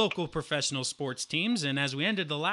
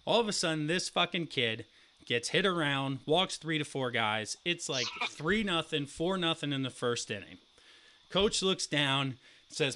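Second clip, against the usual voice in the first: usual speaking voice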